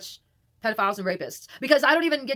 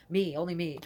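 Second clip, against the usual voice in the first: ordinary talk, nothing marked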